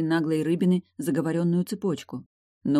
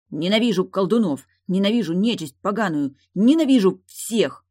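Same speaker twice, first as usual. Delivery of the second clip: grumble, then stretch the final line louder; grumbling hatred; 4 semitones higher, 6 dB louder than usual. A narrator's reading. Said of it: "бубнил он".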